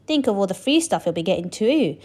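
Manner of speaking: plain reading voice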